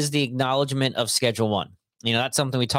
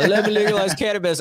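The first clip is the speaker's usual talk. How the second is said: higher-pitched, amateur presidential impression; Bill Clinton; 7 semitones higher, 4 dB louder than usual. Host attempting a president impression for cannabis legalization, as Bill Clinton. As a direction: do a jokey, badly aimed president voice. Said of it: "I was gonna do an impression, but it's gonna be Bill Clinton".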